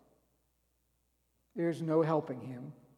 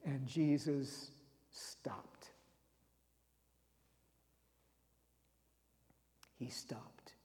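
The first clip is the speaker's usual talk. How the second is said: slow hushed whisper; preacher whispering the moment Jesus stops; 5 semitones lower, 10 dB quieter, much slower than usual. Acted. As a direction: drop to a breathless whisper for the turning point. Hushed dramatic moment in story.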